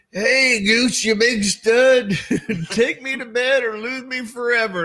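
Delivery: high, slow, hammed-up flirty call; high, slow mock come-on, laughing; mock Meg Ryan. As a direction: camp it up like a flirty starlet. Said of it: "Meg Ryan ... goes".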